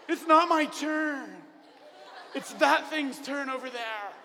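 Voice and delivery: put-on a kid wailing that it's too hard; whiny kid. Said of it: "And you're like".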